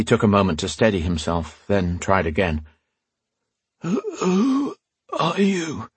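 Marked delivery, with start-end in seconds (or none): 3.8-5.9 frail, high, strained rasp; weak rasp. Acted. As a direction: rasp it out weakly, barely able. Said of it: "he rasped".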